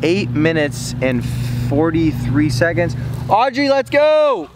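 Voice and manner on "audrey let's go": high, slow urgent call; excited shout. Real, not acted. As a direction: yell for her to hurry.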